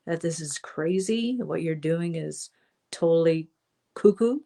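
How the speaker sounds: usual speaking voice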